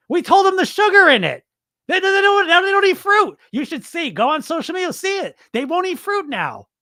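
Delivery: very high, squeaky gloating; hammed-up giddy squeal; mock hysterical executive. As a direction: squeal it as if cracking up at your own scheme. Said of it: "they're laughing so hard".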